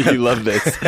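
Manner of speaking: said through a laugh; laughing, teasing. Real, not acted. Laughing at co-host's delight.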